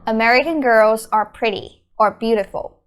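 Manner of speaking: normal talking voice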